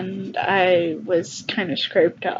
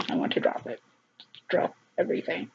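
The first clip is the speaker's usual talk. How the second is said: real whisper; 5 semitones lower, 9 dB quieter, faster than usual. Real, not acted. Telling viewers about dropping the case, real whisper. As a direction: voice fades to a hushed whisper. Emotional retelling trails off.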